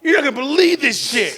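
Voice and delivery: high, keyed-up announcement; dad telling his son news, keyed up; excited news. Retold or imitated. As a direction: burst out with the news. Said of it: "he's like".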